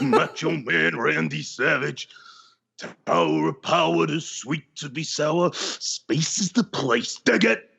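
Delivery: a halting, laughing try at Macho Man; Macho Man impression. Co-host attempts the wrestler's catchphrases, slow and half-laughing. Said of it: "I'm trying to go into a Macho Man, but I can't do it".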